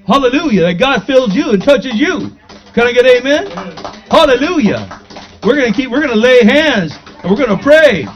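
rally the congregation with exultant praise; fervent preaching. Real, not acted. Preacher calling church to lay hands and pray, fervently.

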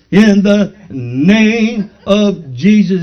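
slow, forceful mock proclamation; overzealous preacher. Preacher showing how the man didn't pray, mock forceful. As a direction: stretch each word like a heavy-handed faith healer. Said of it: "He didn't do this".

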